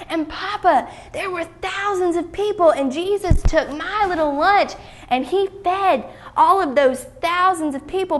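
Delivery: put-on high-pitched, eager child's voice; thrilled young boy, pitched well up; excited boy. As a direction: pour out the amazing news to Mama and Papa. Excited boy tells parents his news.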